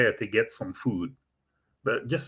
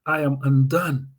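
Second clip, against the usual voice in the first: panting, stammering panic, slightly higher; out of breath; 5 semitones higher than usual. Retold or imitated. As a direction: gasp the words out like you ran all the way. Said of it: "and he's out of breath".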